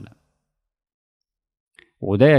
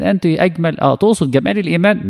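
higher, teasing mock of the compliment; mock flattery; 7 semitones higher than usual. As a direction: tease the empty praise, pitched up. Mocking a flattering claim.

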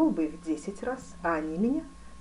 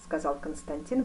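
usual speaking voice

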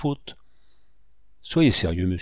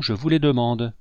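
usual speaking voice

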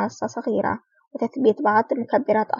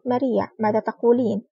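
plain reading voice

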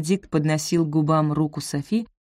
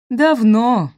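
bright, smiling and louder; cheerful; 6 semitones higher, 8 dB louder than usual. Acted. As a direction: answer merrily with a smile. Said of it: "весело улыбаясь".

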